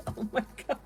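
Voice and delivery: whispered laugh; soft unvoiced laughing gasp; breathless laughing. Real, not acted. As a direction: laugh it out almost silently. Laughing over cat with bread.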